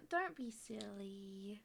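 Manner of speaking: slow unvoiced whisper. Real, not acted. Streamer scolding John, whispered. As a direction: breathe it out slowly.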